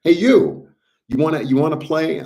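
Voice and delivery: high, loud friendly invite; inviting call. Retold or imitated. Inviting the angels onto the team, high and loud. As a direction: call them over to play. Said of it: "So then you say to them".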